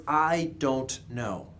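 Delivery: measured, unhurried model phrase; slow, deliberate wording. Shown as a demonstration. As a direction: spell out each word slowly. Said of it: "Think with the words".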